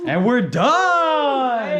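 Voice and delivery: high, slow drawn-out cheer; excited shout. Real, not acted. Finishing the wing install.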